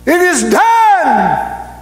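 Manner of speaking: high, drawn-out preacher's shout; long ringing proclamation, pitched high; triumphant cry. Acted. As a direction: shout it out slowly to the heavens. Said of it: "A cry goes forth".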